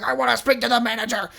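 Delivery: quick mock demand to see the manager; entitled customer. Mocking an angry hotel guest demanding the manager. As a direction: rattle it off like an irate guest.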